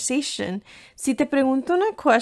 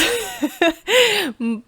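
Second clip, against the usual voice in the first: laughing; 9 semitones higher than usual. Real, not acted. Laughs at own joke.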